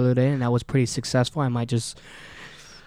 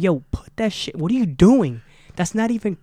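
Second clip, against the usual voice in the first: loud, high, scolding; angry yelling; 6 semitones higher, 6 dB louder than usual. Retold or imitated. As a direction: yell at her to drop it. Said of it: "but I was like".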